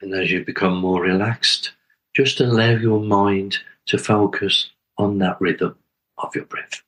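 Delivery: calm, spacious meditation leading; guided meditation. Acted. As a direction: guide listeners gently inward, phrase by unhurried phrase.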